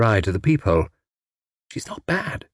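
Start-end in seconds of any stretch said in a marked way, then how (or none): 1.7-2.5 soft, higher-pitched admission; grudging admission. A narrator's reading. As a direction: admit it lightly and quietly. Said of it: "admitted Tuppence".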